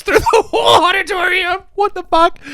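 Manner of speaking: high, cracking laugh-shout of disbelief. Real, not acted. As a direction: laugh it out, can't believe this. Laughing at absurd plot.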